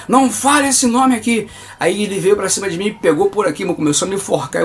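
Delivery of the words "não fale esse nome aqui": higher-pitched, sharp order; angry demon command. Retold or imitated. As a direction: imitate an angered demon forbidding it. Demon angrily forbids the name.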